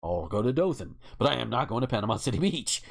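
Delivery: ordinary talk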